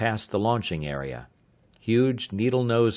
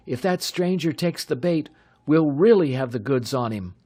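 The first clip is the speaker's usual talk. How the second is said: higher, excited youth; eager young man; 7 semitones higher, 5 dB louder than usual. A narrator's reading. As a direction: pitch the plan with glee. Bud concludes.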